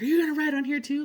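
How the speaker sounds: high, loud and fast, an irritated challenge; imitating a pissed guardian. Guardian spirit confronting the intruder, in a mock angry, high, loud, fast voice. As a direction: snap it out like an annoyed spirit guarding his wall. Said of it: "he was pissed".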